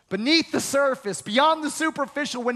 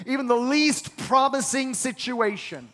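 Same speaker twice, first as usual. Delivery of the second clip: usual speaking voice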